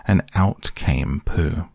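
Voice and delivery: ordinary delivery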